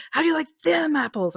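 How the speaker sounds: high, jeering braggart voice; a lightly voiced apple-throwing bully; mock taunt. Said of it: "Or was it".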